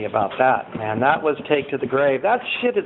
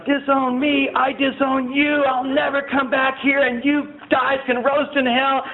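retold furious yell; 7 semitones higher than usual. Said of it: "I say some horrible things".

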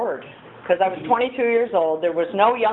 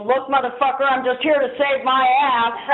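mock tough snap; 6 semitones higher than usual. Snapping at old man.

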